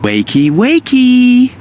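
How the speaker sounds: slow, loud singsong wake-up; loud wake-up call. Acted. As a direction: drag it out to wake him. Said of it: "See how that works?".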